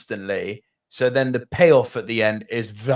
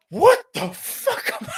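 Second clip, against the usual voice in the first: much higher, slower disbelieving laugh; whiskey laugh. Retold or imitated. Recalling the plasma breath, hoarse whiskey laugh. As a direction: howl with disbelief. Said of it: "a proper whiskey laugh came out of me".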